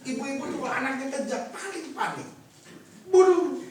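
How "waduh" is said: panicked mother's exclamation. Acted.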